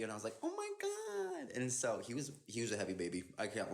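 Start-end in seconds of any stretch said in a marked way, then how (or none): 0.4-1.5 retold reaction in a very high, slow squeal; high-pitched awe. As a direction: squeak it out slowly, imitating amazement. Retold reaction to holding baby.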